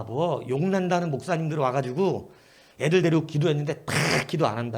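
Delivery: ordinary delivery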